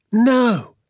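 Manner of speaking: high, loud disbelief; astonished no. A narrator's reading. Boy reacting to his father's plan, astonished. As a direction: exclaim it high in amazement.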